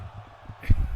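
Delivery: short breathy disbelieving laugh. Real, not acted. Laugh after near fall.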